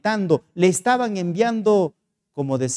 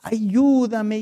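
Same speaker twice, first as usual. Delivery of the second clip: higher, drawn-out plea; acted supplication; 5 semitones higher, slower than usual. Hotel owner shows the pastor how she prays, imploring. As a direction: imitate someone begging in prayer, stretching the words. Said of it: "cómo suplicas a Él".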